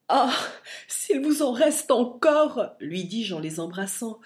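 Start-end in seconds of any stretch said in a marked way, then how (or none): 0.1-2.8 high, slow pleading; imploring. A narrator's reading. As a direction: beg through rising grief. Fils implore son père en pleurs. (supplication lente et aiguë)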